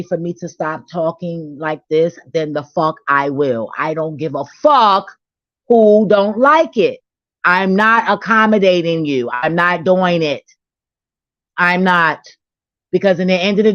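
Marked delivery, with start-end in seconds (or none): 4.6-7.0 slow, loud and higher, spitting each word; angry rant. Real, not acted. Heated rant against critics. 7.4-9.3 louder, hitting NOT hard; angry rant. Real, not acted. Heated rant against critics.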